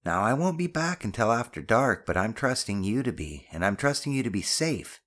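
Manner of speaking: fond but firm parental warning; stern, loving mother. Acted. Voices the mother lecturing.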